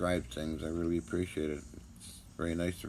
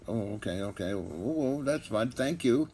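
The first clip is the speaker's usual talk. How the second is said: excited; 5 semitones higher than usual. Retold or imitated. Acting out his reaction on tour.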